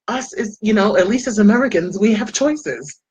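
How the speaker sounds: ordinary talk, nothing marked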